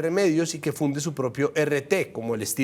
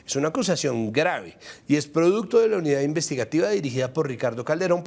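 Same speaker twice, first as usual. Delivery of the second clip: normal talking voice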